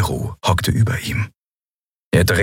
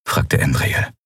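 plain reading voice

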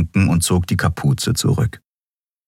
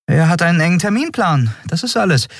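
high-pitched, brisk brush-off; dismissive Endriel; 13 semitones higher, faster than usual. A narrator's reading. Captain explaining away the hurry, dismissive. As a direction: shrug it off in a high voice.

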